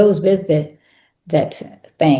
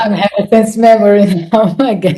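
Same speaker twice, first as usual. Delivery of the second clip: laughing; 3 semitones higher, 5 dB louder, faster than usual. Real, not acted. Laughing at own reaction.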